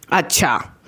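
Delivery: understated, ominous acknowledgement; mother's disapproving reaction. Retold or imitated. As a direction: imitate a displeased mom's falling okay. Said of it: "मेरी mummy का reaction एकदम opposite". (नाराज़ माँ का ठंडा अंदाज़)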